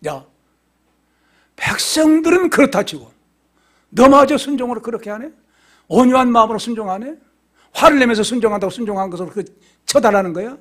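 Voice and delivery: indignant questions fired as God's reproach; wrathful rebuke. Retold or imitated. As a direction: take the servant to task loudly. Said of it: "진노하신 거예요".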